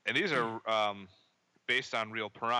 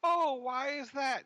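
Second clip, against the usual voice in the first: high squeaky mock lady voice, much higher; big woman's voice; 6 dB louder, slower than usual. Retelling a movie scene to a co-host, high mock lady voice. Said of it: "this big woman comes up to him and goes".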